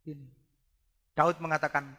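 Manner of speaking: ordinary delivery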